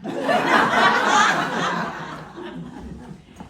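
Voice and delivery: very high, very loud burst of laughter; shrieking laugh. Acted. Young man shrieking with laughter before the Duke.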